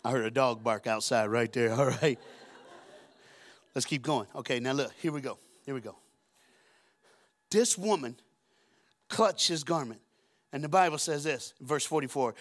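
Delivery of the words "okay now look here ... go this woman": ordinary talk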